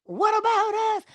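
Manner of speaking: hammed-up, needy appeal; pleading old attitude. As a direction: plead from way up high. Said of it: "the old comes up and says".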